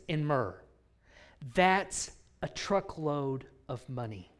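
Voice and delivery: ordinary delivery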